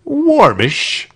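high, airy admission; mild executioner. A narrator's reading. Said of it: "admitted the executioner".

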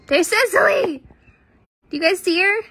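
high, loud delighted exclamation; thrilled to spot a favourite cat; excited spotting. Real, not acted.

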